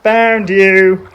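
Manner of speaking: high, loud little koala; Koala's voice. Acted. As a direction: chirp it out like a cheerful koala. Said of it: "said Koala".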